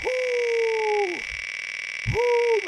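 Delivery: long high whooping exhale; a breathless high whoo. Real, not acted.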